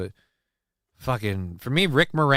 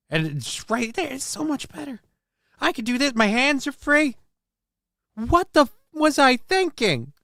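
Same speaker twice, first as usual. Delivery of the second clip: Rick Moranis voice; 12 semitones higher than usual. Acted. Streamer delighted with his desk, in a high Rick Moranis voice. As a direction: marvel like a nerdy little man. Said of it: "why are you talking like Rick Moranis?".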